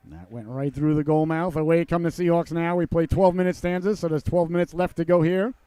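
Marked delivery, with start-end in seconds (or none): none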